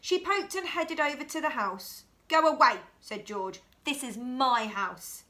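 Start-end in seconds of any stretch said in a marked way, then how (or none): 2.3-2.8 louder, blunt; boy guarding his house, loud go away; loud shoo. Acted. George shoos Rashida. 3.9-5.2 slow, with "my" leaned on; stress on my. Acted. George's slow claim.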